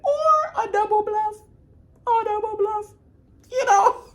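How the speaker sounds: very high, playful squeal; silly high-pitched aside; high teasing voice. Pretend. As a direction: pitch it way up, mock-suggestive. Playfully offers a counter-theory.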